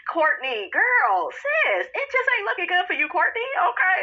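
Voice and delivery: put-on high, sing-song girl-talk scold; high camp girlfriend warning; sassy scolding friend. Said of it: "I'm like".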